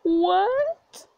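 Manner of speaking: high rising stunned question; can't believe what she just read; shocked what. Real, not acted. Shocked by the game text.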